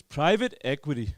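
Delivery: put-on American accent, called out; American guy. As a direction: imitate a confident American. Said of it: "This American guy goes".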